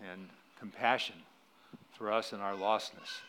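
hushed closing prayer. Real, not acted.

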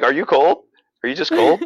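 playfully retelling his clueless question to a spooked friend; incredulous ask. Retold or imitated. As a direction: ask like the fear makes no sense. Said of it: "I love that".

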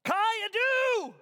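long, high, loud shout; yelled. Acted. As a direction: yell the Greek slowly. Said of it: "willing to yell it".

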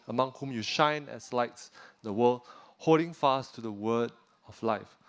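ordinary delivery

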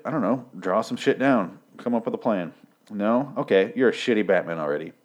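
ordinary talk

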